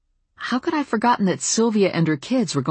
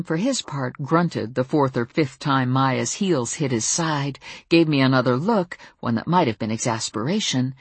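usual speaking voice

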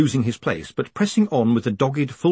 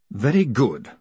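ordinary delivery, nothing marked

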